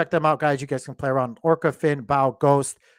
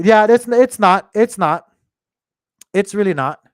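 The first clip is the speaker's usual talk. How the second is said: louder, firmly insisting; emphatic insistence; 6 semitones higher, 11 dB louder than usual. Real, not acted. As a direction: push back hard that it's not bad. Insisting the price drop is fine.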